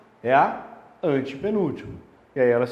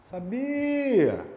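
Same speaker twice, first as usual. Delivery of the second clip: "Sabia!" called higher as if to someone distant, stress on BI; calling someone far off; 7 semitones higher than usual. Shown as a demonstration. Teacher shows calling a distant person to find the stress, higher call. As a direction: call out "Sabia!" across a room. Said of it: "você vai se imaginar chamando".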